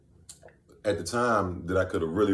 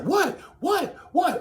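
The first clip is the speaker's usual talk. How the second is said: mock startled girl; much slower than usual. The girl in the car, startled, shrieking high. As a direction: squeak it out like her alarmed.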